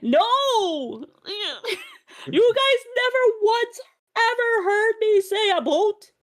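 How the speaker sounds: high-pitched, laughing outrage; indignant protest. Real, not acted. Accused Canadian protests to her friend, high indignant outburst.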